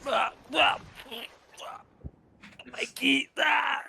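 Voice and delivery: high, loud anguished cry; anime dub. Acted. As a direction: cry out in pain. Dubbed Gon crying out.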